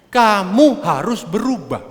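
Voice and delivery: slow, high, emphatic order; acted slow, insistent ultimatum; stern demand. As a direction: lay down the law to a wife, word by word.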